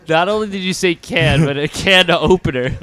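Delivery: loud laughing mockery. Real, not acted. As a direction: laugh through the words, teasing. Mocking friend's slip, laughing.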